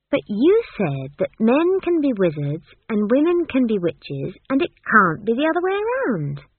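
put-on high, loud arguing child; insistent young Esk. Esk quotes Granny's rule back, insistent. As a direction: press the logic in a girl's voice.

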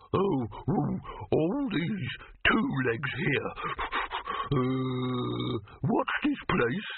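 a lost, simple-minded hound; dim dog voice. Acted. Dim wonder dog rambling.

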